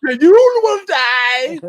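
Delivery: imitating the movie Nazi soldier's menace; Nazi villain. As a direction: ham up the villain's threat. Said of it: "he's all like".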